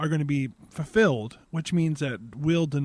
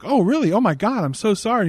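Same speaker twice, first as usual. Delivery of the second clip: higher and louder, flustered oh my God; mock alarmed apology; 5 semitones higher, 6 dB louder than usual. Trucker on the radio caught out, hammed-up panic. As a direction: play the panicked driver. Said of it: "on the radio is like".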